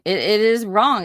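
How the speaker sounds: indignant. Real, not acted. Streamer repeats outrage about stolen valor.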